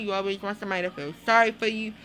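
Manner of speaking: ordinary talk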